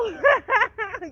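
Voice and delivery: high, loud burst of laughter. Real, not acted. Laughs mid-rant.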